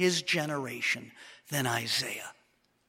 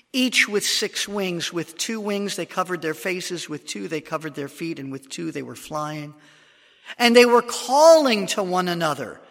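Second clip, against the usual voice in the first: dramatic scripture reading; 7 dB louder than usual. Acted. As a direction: read it like the throne room is before you. Reads Isaiah's vision aloud.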